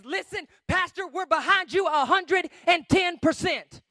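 loud, high pledge of loyalty; rallying support. Acted. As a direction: declare full backing with force. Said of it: "be the people that says".

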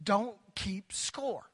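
spelled out slowly; slow emphatic. Acted. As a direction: lay each word down deliberately. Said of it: "preached emphasis".

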